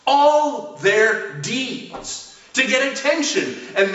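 "All" lifted high and drawn out, slow; stress on ALL. Real, not acted. Preacher to congregation, re-reading the verse with "All" stretched high. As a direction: lean hard on "All" to drive the verse home.

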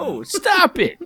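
high-pitched protest. Real, not acted. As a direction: squeal it in protest. Co-host protests at the joke.

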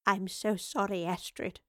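soft, hushed regret, still lightly voiced; whispered apology. A narrator's reading. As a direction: apologise quietly, trailing down in volume. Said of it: "the professor whispered".

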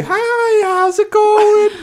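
squeaky high, drawn-out hello; mock-cheery staff greeting; high falsetto greeting. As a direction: chirp it way up, slowly. Retold greeting in put-on voice.

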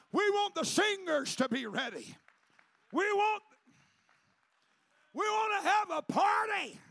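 high, loud shouted climax; preaching at full force. Acted. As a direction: whip the church up toward revival.

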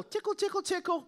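high-pitched sing-song tickle; playful tickling. Pretend. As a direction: tease it lightly, up high. Playful demo of tickle-then-punch style.

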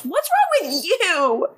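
high, drawn-out mock yell; mock Klaus yell. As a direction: imitate an outraged yell, slow and high-pitched. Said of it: "And Klaus yells".

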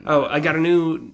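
usual speaking voice

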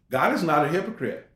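ordinary delivery

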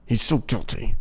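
pissed off viewer. Pretend. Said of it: "the pissed off Making a Murderer viewer".

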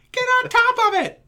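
loud, very high-pitched yell; high, loud impression of a woman yelling; imitated yelling. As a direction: mimic her yelling out an order at full pitch. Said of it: "She's ye-, yelling".

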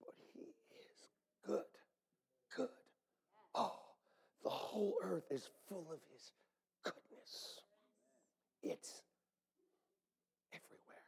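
soft breathy whisper of awe; hushed whisper. Acted. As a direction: whisper it like a secret. Whispered after loud chant.

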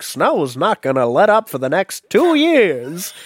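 loud, high-swooping forecaster; hammed-up Weather Channel warning; TV weathercaster. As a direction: punch it out like a dramatic weather report. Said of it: "watching the Weather Channel being like".

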